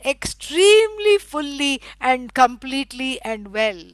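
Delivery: ordinary talk, nothing marked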